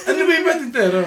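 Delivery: high and loud, through laughter; laughing. Real, not acted. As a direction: ask it while cracking up. Laughing at game mixup.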